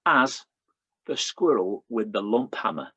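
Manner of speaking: lightly comic poem recital; poem reading. Acted. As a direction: read it with playful timing.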